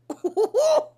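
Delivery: loud high burst of laughter; real cackle breaking out. Real, not acted. Recapper laughing at the drama, high loud burst.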